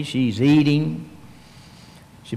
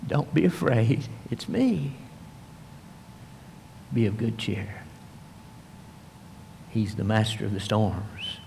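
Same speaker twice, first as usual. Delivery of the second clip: quiet and low, tender reassurance; soft comfort; 4 semitones lower, 9 dB quieter than usual. Acted. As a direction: comfort them softly as Jesus would. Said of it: "he'll whisper".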